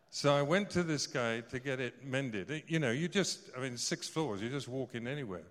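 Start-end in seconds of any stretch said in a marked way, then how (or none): none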